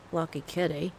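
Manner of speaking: put-on a half-hearted try at an Aussie accent; imitating Cyrus in a faint Australian accent; Australian accent attempt. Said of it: "I can't do the accent".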